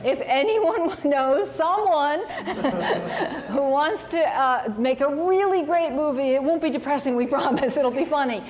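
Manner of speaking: bright laughing plea, laughs breaking through; real amused giggling while half-joking about movie rights; laughing pitch. Real, not acted.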